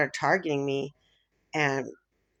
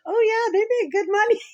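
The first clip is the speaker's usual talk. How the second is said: high, loud breezy mom voice; imitating mom; 8 dB louder than usual. Daughter imitating her mom's casual advice, high and breezy. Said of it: "She's like".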